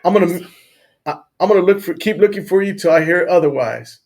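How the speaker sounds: loud, heartfelt call to a lost dog; shouted message. Retold or imitated. Dog owner calling out his goodbye to Rocky, loudly. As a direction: project it out over the hills, earnest.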